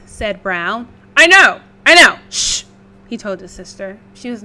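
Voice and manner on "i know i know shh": hushing pig. Acted.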